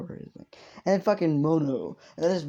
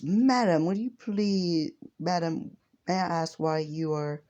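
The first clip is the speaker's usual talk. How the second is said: put-on higher, courteous young hero asking Six; polite hero voice, pitched up; 5 semitones higher, slower than usual. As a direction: lift the voice into an earnest, overly polite hero.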